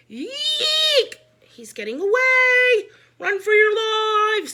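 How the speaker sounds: high, loud, panicked squeal; terrified shriek. A narrator's reading. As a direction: shriek like a kid fleeing a bug. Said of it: "said Neil the new kid".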